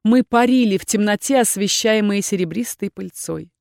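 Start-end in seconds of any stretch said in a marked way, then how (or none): none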